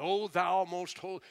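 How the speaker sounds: hammed-up King James oratory; mock pompous prayer. Preacher mocks praying in thee and thou, pompous. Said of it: "You don't talk to people like that".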